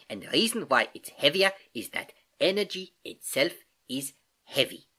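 put-on accented uncle teaching, key words lifted; patient Uncle Albert, explaining. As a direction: explain it like an eager old professor uncle. Uncle Albert explains, building to point.

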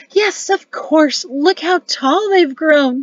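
high, loud, drawn-out grandmotherly delight; delighted cry. A narrator's reading. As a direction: cry out slowly, marvelling at the grandchildren. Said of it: "she cried".

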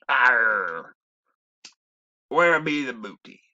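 growled pirate cry, loud. Acted. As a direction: bark it like a pirate after treasure.

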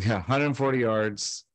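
normal talking voice

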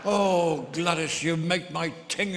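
loud, slow hammed-up leer; mock lustful moan. Lustful old man to Gladys.

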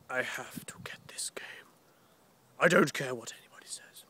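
intense, voiceless whisper; whispered quote. Retold or imitated. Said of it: "I was like".

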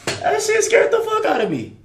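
high-pitched shaken exclamation. Real, not acted. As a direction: voice jumps up after a scare. Jump scare in horror game.

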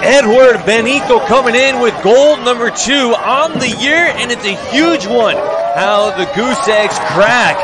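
high, loud celebratory commentary; excited goal celebration. Real, not acted.

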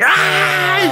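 high-pitched mock scream of rage; crazed scream. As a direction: scream like someone driven crazy by a prank. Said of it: "You hear the person go crazy".

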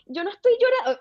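high-pitched mock-tearful protest; retold shocked distress. Daughter answering her mother in shock, retold distress. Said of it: "o sea, en shock".

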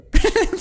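short high burst of laughter. Real, not acted. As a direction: laugh out loud at the silly path. Laughs at enemies' strange path.

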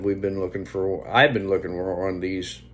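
ordinary talk

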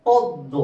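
পদ্ম said firmly as pod-do; silent ম. Shown as a demonstration. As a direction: double the d in পদ্ম, no m. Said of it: "ময়ের উচ্চারণ হচ্ছে না".

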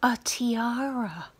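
secret whisper, breathy; whispered answer. Acted. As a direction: let the answer slip out as a hushed secret. Whispered answer to own trivia question.

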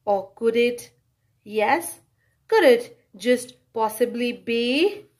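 ordinary delivery, nothing marked

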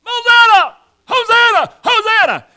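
joyful shouted chant; crowd shouting. Retold or imitated. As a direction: cry out like the jubilant crowd. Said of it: "people are shouting".

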